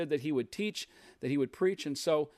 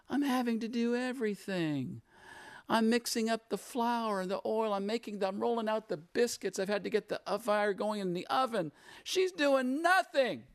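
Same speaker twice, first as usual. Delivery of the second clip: acted exasperation; 7 semitones higher than usual. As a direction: play a put-upon sister listing her chores. Preacher voices upset Martha complaining.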